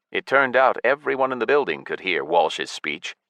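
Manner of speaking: ordinary delivery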